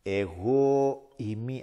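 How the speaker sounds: slow, solemn proclamation; Christ's words. Retold or imitated. Preacher voicing Christ's own claim, slow and solemn. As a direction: let each word land like a divine claim. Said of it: "Ο Χριστός όμως είπε".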